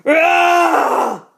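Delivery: huge high, very loud drawn-out roar; lion roar. A narrator's reading. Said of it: "He put down the basket and roared".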